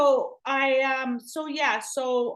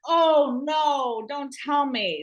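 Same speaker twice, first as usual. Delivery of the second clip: louder, higher alarmed cry; dismayed outburst; 4 semitones higher, 6 dB louder, much slower than usual. Retold or imitated. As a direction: blurt it out in alarm. Inner panic at the name.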